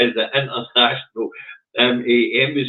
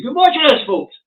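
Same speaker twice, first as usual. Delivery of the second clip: excited teaser; 7 dB louder than usual. Real, not acted. Teasing the next clip.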